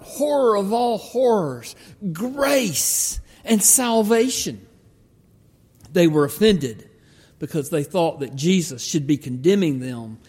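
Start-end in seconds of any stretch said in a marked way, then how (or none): 0.0-4.6 mock horror. Mimicking Pharisees' outrage, ironic.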